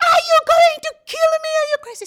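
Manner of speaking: shrill-free panic, high and rushed; frightened cry. Retold or imitated. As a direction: plead in terror as he chokes her. Being strangled by her husband.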